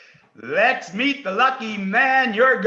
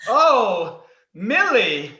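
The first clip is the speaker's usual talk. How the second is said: normal talking voice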